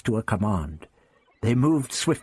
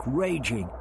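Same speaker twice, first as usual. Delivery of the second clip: plain reading voice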